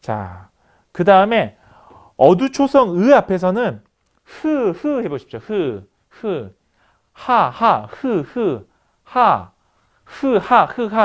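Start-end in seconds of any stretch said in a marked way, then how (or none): 4.2-5.0 흐 sounded out alone, higher, as separate puffs; demonstrated 흐 sound. Shown as a demonstration. Teacher has students feel where 흐 is articulated, demonstrating. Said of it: "조음되는 위치 좀 다른 거". 5.4-11.0 isolated breathy h syllables, 흐 then 하, each separate; contrasted 흐 and 하. Shown as a demonstration. Teacher contrasts articulation places for students, sounding out 흐 and 하. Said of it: "조음되는 위치 좀 다른 거".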